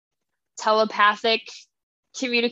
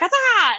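high mock yelp of pain, hammed up; a quick hammed-up shriek; mock cry; 10 semitones higher, 4 dB louder than usual. Heroine yelps while retelling the fight, mock yelp.

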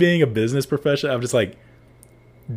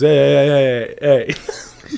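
mock call, a bit louder, repeating hey; imitated calling out; 3 semitones higher, 6 dB louder, much slower than usual. Retelling the guy at the car calling out to him, mock calling. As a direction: call after them like the guy did.

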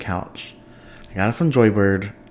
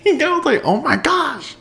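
laughing oh my gosh; 3 dB louder than usual. Retold or imitated. Retelling panic after purchase.

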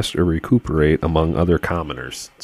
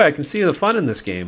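ordinary talk

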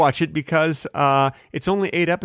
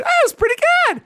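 Oh, it's pretty good squealed far higher and louder; excited pretty good; 5 dB louder, much faster than usual. Shown as a demonstration. Showing a co-host the overexcited version, high gush. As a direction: pitch pretty way up, gushing. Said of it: "If you say a movie is".

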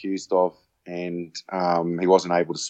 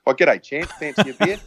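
high, sing-song mock welcome with a laugh in it; teasing greeting; 7 semitones higher, 4 dB louder than usual. Teammate George teasing the late arrival at the bar, cheeky. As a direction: rib your mate with the nickname. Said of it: "George said to me".

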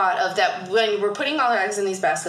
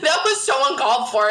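higher, emphatic apology through laughter; laughing mock apology; 5 semitones higher, 4 dB louder than usual. Mother retelling her apology to her child, laughing. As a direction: retell a mortified apology while cracking up.

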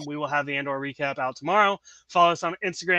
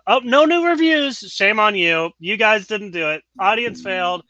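playful telling-off, raised voice; mock scolding; 4 semitones higher, 7 dB louder than usual. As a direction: jokingly shame the listeners. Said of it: "Just kidding".

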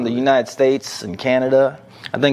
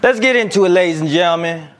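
hype intro; 5 semitones higher than usual. Acted. Hype show opener.

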